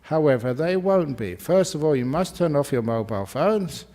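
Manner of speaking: ordinary talk, nothing marked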